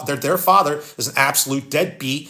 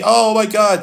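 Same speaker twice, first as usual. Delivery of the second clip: mock whine, higher and louder; whiny complaining; 5 semitones higher, 5 dB louder, slower than usual. Mocking miserable people who haven't earned things, whiny and complaining. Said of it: "they get whiny and bitchy and complaining".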